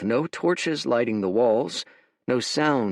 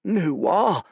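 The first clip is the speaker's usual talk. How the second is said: croaked; 8 semitones higher, 4 dB louder than usual. Acted. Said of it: "croaked Fate".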